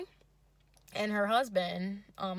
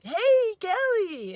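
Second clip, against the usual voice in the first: much higher, louder goofy date voice; high silly voice; 12 semitones higher, 9 dB louder, much slower than usual. Retold or imitated. Date greets her in a high, loud, silly voice. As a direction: squeal it out like an overeager date. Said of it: "and he's like".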